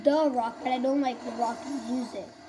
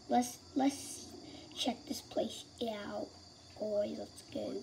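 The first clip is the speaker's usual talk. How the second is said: sneaky unvoiced whisper; 2 semitones lower, 9 dB quieter, slower than usual. Real, not acted. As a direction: hush it like you're creeping in. Sneaking into a building.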